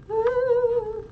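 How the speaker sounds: high, drawn-out spooky ooh; ghost voice. Pretend. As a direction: moan it like a playful ghost. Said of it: "That's my ghost voice".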